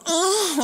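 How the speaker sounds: squeaky hammed-up moan; a silly high moan; high mock moan. Storyteller voicing the woman, a squeaky mock moan.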